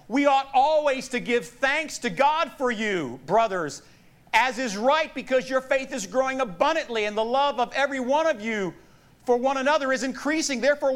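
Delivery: high, ringing proclamation of a verse; scripture reading. Acted. Preacher reads Paul's thanks to the Thessalonians, proclaiming. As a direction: declaim it to the whole congregation.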